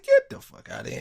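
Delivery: sky-high incredulous outburst; you cannot be serious, pitched very high; disbelief. Retold or imitated. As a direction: shoot way up in pure disbelief. Reacting to the thirty-thousand claim.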